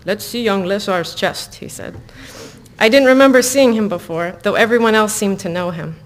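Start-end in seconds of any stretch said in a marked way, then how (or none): 0.0-1.5 hokey, slow Russian accent; a self-conscious stab at Russian. Shown as a demonstration. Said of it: "it's, like, really hokey".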